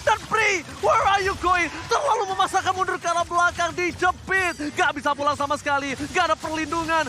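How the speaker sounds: hyped esports play-by-play; loud caster calling the fight; fighting game announcer. Real, not acted. Live play-by-play call.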